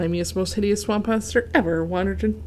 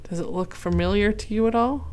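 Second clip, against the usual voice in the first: plain reading voice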